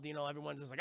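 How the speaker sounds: ordinary delivery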